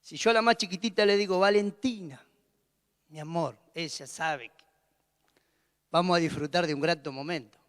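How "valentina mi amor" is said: soft, tender calling of her name. Shown as a demonstration. Father gently calling his youngest daughter, soft and tender. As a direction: call her name sweetly, like a treat is coming. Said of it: "la llamo con un tono".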